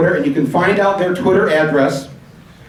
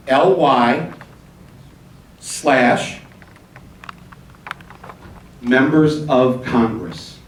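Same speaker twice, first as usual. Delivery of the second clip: slow dictation with long pauses; deliberate, evenly spaced letter-by-letter readout; slow reading; much slower than usual. Shown as a demonstration. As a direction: read out the address piece by piece, slowly. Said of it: "but I'll read it slow".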